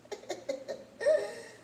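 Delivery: high laugh. Real, not acted. Joke about skipping counseling.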